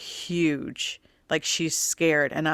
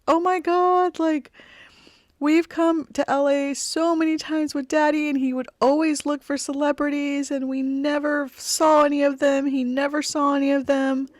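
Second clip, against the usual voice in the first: mock bawling; 9 semitones higher than usual. Daughter sobbing to mom about Dad and celebrities, high wailing voice. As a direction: play the daughter bawling over Dad, much higher and wailing. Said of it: "immediately started bawling".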